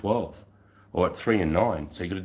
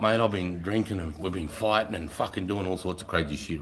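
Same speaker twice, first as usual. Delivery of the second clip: ordinary delivery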